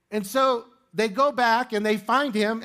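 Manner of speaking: ordinary talk, nothing marked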